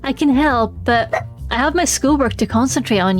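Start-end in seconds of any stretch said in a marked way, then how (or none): none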